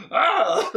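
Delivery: loud high yelp; high loud startled cry; startled shriek. Retold or imitated. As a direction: yelp in fright. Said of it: "And I'm like".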